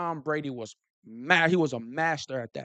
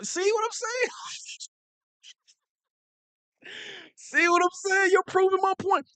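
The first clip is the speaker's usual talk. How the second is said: mocking high voice. Teasing chat commenter, mocking.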